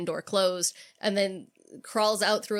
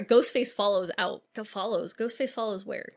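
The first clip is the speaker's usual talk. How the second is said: usual speaking voice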